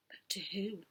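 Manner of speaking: unvoiced secret whisper. A narrator's reading. Narrator whispering a question to the listeners. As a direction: lean in and whisper.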